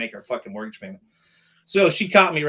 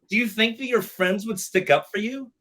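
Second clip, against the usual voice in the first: high, mocking instructor voice; 7 semitones higher, 4 dB louder than usual. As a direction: throw her scornful questions back at him. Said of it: "She goes".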